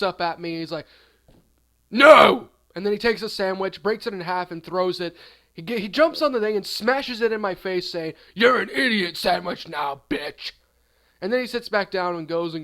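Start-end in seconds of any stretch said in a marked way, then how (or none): 1.9-2.5 one huge explosive mock roar; hammed-up monster's shout; nasty yell. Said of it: "a really nasty little monster". 8.3-10.5 loud, rough gloating insult; hammed-up abusive sandwich guy; nasty yelling. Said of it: "a really nasty little monster".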